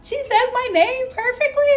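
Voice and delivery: high, giddy reenacted amazement; freaking out inside. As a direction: marvel at it, voice climbing into laughter. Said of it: "I'm freaking out inside".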